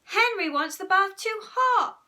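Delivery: high, loud protest; whining child at full volume; shouted tale-telling. A narrator's reading. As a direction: shout the complaint to Mum. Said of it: "shouted Peter".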